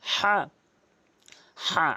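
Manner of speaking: হা breathed hard from the middle of the throat; a raspy throat h for হা; হা pushed out from the throat, a strong breathy h; Arabic ha from mid-throat. Shown as a demonstration. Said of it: "হলকের মাঝখান থেকে উচ্চারণ করতে হয়".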